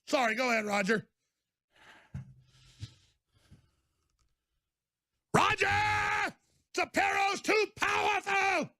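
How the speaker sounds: high, soft, swooning fanboy voice; mocking a simpering Shapiro fan, high and soft; fawning mock admirer.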